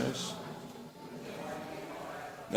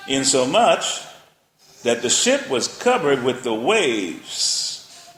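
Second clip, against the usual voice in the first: dramatic scripture reading; 2 semitones higher, 3 dB louder, much slower than usual. Acted. Preacher painting the storm for the congregation, loud and slow. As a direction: build the storm with the voice.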